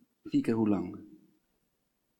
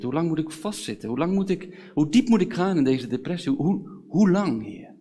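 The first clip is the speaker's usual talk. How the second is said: raised, mounting plea; anguished questioning that builds louder, then drops; desperate lament; 4 semitones higher, 4 dB louder than usual. Acted. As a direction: wrestle out loud with God, stumbling and surging. Acts out despairing lament to God.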